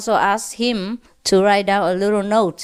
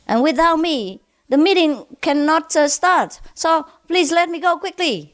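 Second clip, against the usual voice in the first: raised, pleading voice of a self-important driver; lightly voiced driver begging the policeman; pushy driver; 7 semitones higher than usual. Driver pleads with policeman to let him go, pitched up. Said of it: "the driver said".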